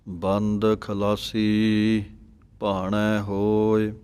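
slow, with ਬੰਦ said soft, not pressed. Shown as a demonstration. Teacher models a soft ਬੰਦ. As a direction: say ਬੰਦ gently. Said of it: "ਪਾਠ ਨੂੰ ਪੜ੍ਹਨਾ ਹੈ ਬੰਦ, ਪੋਲਾ ਜਿਹਾ".

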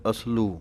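ਅਸਲੂ as as-loo, s joined straight to l; no vowel between s and l in ਅਸਲੂ; careful ਅਸਲੂ with a single l; correct reading. Shown as a demonstration. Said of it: "ਪਾਠ ਹੈ".

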